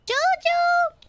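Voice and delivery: high call across the room; calling the cat. Real, not acted. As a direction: sing out the cat's name to fetch him.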